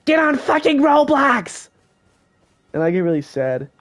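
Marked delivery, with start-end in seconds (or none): 0.0-1.7 much higher, slow, stretched mocking yell; imitating a classmate who nags every day; mock whiny yell. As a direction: drag it out like the annoying kid next to you. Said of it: "every single day he just goes".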